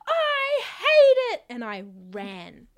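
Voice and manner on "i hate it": very high, loud, slow laughing wail; hammed-up childish howl; child's wail. Child howling at mum about her haircut. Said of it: "And I was like".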